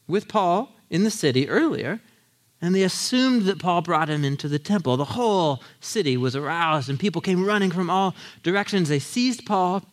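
ordinary talk

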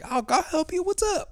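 squeaky-high, over-helpful offer; mock eager helper. Offering help to the Parisian in his story, mock eager high voice. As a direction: send the voice way up, playing keen to help.

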